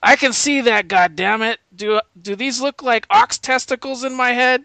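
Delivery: hammed-up cussing commander; profane general. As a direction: snap back like an insulted general. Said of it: "and he goes".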